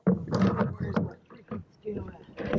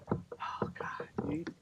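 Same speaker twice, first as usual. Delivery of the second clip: whisper; 5 semitones higher, 13 dB quieter, slower than usual. Real, not acted. Hooked fish, hushed hope.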